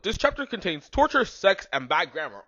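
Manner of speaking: loud and high, like a mock grand announcement; mock announcer. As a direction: read it like a pompous warning. Mocking author's note read.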